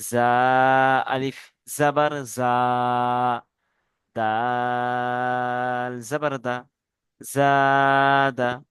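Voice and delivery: letters chanted very slowly, one by one; slow spelling drill. Shown as a demonstration. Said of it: "তারপরে বানান করবেন".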